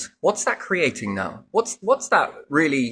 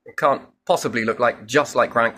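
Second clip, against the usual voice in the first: normal talking voice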